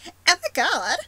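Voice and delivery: put-on high, loud Valley Girl squeal; over-the-top Valley Girl voice. Shown as a demonstration. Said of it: "I can do a Valley Girl".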